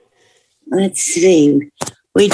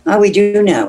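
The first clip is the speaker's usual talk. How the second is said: ordinary talk, nothing marked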